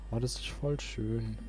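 usual speaking voice

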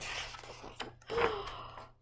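sharp high gasp. Pretend. Reacting to the flea for the child, a mock gasp. Dramatic story moment.

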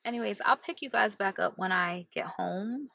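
normal talking voice

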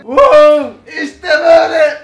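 high loud wow; excited shout. Real, not acted. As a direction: cheer at the reveal. Excited reveal of domino art.

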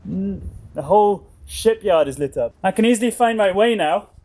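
ordinary talk

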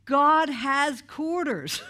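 slow, loud shout across the lawn; joyful yell. Retold or imitated. Angela yelling to the campers across the lawn, slow joyful shout. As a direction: call it out, drawn out and thrilled. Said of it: "she's yelling at all of us".

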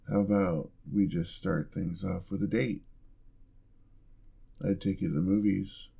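ordinary talk, nothing marked

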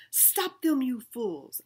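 commanding shout. A narrator's reading. Sherman commanding the angry crowd, loud and bossy. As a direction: bark the order at the crowd. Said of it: "I commanded".

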